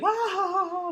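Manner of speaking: high, sustained mock guffaw; put-on uproarious laugh; imitated crowd laughter. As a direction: fake an audience roaring too early. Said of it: "they laugh at the setup".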